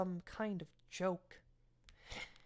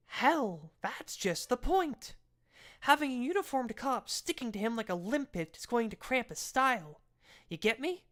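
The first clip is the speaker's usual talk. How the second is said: impatient captain, higher and pushing; lightly voiced captain losing patience; a touch of exasperation from the boss; exasperated captain; 6 semitones higher, 3 dB louder than usual. Custer presses his point.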